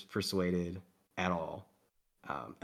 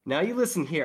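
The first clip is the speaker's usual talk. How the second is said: higher, louder menacing guard line; threatening guard; 9 semitones higher, 6 dB louder than usual. Acted. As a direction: lean in and warn him off.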